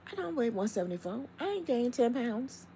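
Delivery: high, incredulous protest; indignant disbelief. Real, not acted. Protesting the scale's number, high and incredulous. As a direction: push back, outraged and high-pitched. Indignant rant about her weight.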